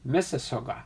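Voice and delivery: "Mississauga" run together as one quick word; quick, joined-up "Mississauga"; said fast. Shown as a demonstration. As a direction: say "Mississauga" briskly, without breaks. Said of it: "say that really fast".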